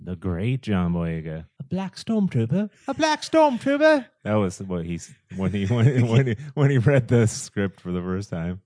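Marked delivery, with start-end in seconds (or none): 1.6-2.7 a rough attempt at John Boyega's London accent, higher and rising; fumbling British accent, higher, ending on a question; mock London accent, asking in disbelief; bad Boyega accent. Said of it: "that was a horrible version of his accent". 2.9-4.1 put-on much higher, louder London accent, falling at the end; a hammed-up Boyega, pushed up high and loud; exaggerated British accent, loud and high, in mock disbelief; bad Boyega accent. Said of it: "that was a horrible version of his accent".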